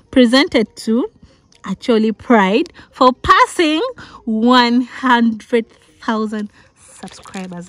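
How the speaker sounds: loud proud reading, slowing to savour it; excited reading. Real, not acted. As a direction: read the plaque loud and proud, stretching it out. Reads her award plaque aloud.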